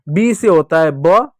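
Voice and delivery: slow lead-up to a drawn-out ब; letter sound demo. Shown as a demonstration. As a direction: show ब as a stretched "buh" sound. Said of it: "sound जान लेते हैं".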